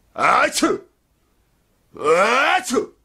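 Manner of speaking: two loud high theatrical sneezes; hammed-up double sneeze. A narrator's reading. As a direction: sneeze twice with gusto. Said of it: "E fece altri due starnuti".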